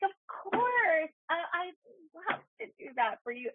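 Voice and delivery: mock the sweet automatic yes; eager people-pleaser. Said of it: "you're like".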